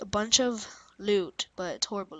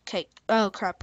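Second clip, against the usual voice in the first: normal talking voice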